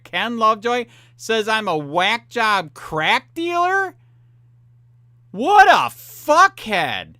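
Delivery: loud, high, slow furious yelling; raging tirade at the man who mocked him; furious rant. Real, not acted. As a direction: spit the insults out with real rage. Furious insults at Ken Lovejoy.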